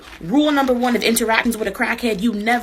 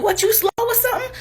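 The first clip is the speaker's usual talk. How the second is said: much higher, squeaking out his question; high squeaky crackhead; 13 semitones higher than usual. Retold or imitated. Crackhead asking her what bon voyage means, high squeak. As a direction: pitch it way up like a baffled stranger.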